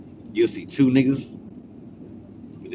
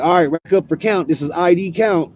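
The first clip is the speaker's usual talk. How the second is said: calling out count; 9 semitones higher, 8 dB louder than usual. Retold or imitated.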